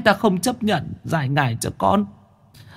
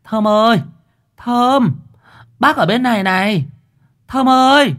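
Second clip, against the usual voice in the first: calling out; 4 semitones higher than usual. Acted. Calling across the street.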